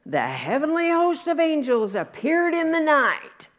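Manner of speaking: high, loud, grand announcement of the angels; heavenly host announced with awe; awed proclamation. Shown as a demonstration. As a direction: proclaim it with wonder.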